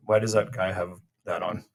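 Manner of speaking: normal talking voice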